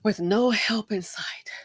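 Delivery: a slow, breathless whisper of real despair. Real, not acted. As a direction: let it sink to a slow whisper. Upset rant about people suffering.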